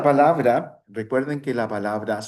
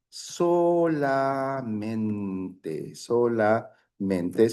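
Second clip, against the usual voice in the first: slow demo; much slower than usual. Shown as a demonstration. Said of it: "these two words are the same".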